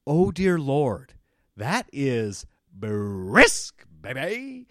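drawn out like a prim lady hit by cold water; shocked by the cold. Retold or imitated. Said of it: "it's like".

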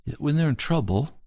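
ordinary talk, nothing marked

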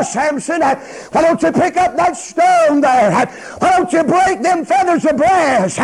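loud, high taunting shout; mocking jeer. Philistines taunting captive Samson, mocking him in shouts. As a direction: jeer at full preaching force. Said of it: "in mockery and humiliation".

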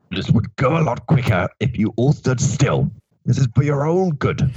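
a hint of a weary warrior; mildly voiced War Doctor. A narrator's reading.

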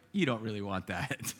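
low, soft aside as God; a quiet, amused turn-down; gentle refusal. Acted. God quietly turning down a prayer, low amused murmur.

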